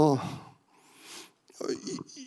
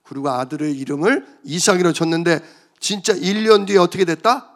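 ordinary talk, nothing marked

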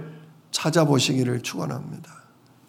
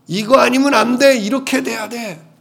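stubborn insistence; 7 semitones higher than usual. Acted. Preacher acts stubborn old self.

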